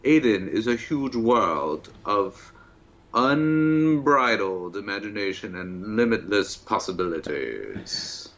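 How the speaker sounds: slow, grand trailer narrator; overdramatic voiceover. Pretend. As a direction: intone it like a cheesy game trailer. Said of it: "Did you do the voiceover for this game?".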